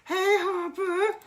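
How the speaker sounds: very high, slow squeaky greeting; chat greeting chorus. Pretend. Host cueing chat, squeaky high greeting. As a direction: squeak it out like a cartoon crowd. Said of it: "Everybody say".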